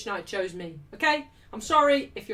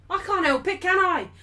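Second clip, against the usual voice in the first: high-pitched indignant outburst; crashing out; 8 semitones higher, 5 dB louder than usual. Real, not acted. Streamer defending herself to chat over her club, crashing out. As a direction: protest it in exasperation. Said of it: "big crash out there".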